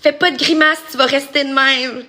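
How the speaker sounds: scolding grandma. Retold or imitated. Grandma warning kids off making faces, mock scolding. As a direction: play a grandma's emphatic warning. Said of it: "les grands-mamans, là, ils disent".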